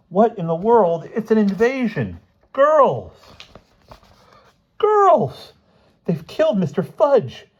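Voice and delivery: put-on high, loud panicked boys; panicked shouting. A narrator's reading. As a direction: yell the alarm down the hall.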